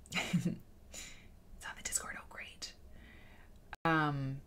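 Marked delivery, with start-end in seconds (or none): 0.1-2.7 slow, breathy whispered aside off-mic. Real, not acted. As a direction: whisper it to the side, slowly.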